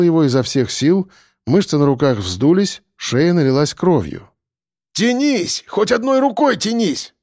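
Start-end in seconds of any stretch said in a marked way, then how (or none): 4.9-7.1 high strained shout of effort; shouted urging. A narrator's reading. Kolya hauling the boy up, shouting urgently. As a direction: yell at the boy to reach up. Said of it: "крикнул Коля".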